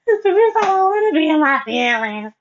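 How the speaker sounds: crying plea. Retold or imitated. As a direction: beg through tears.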